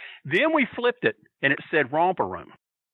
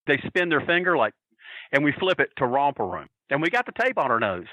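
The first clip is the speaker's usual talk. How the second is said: ordinary talk, nothing marked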